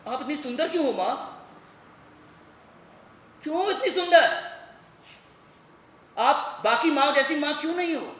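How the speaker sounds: pained, heated demand; angry confrontation. Acted. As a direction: burst out at her, pitch climbing. Son confronts his mother.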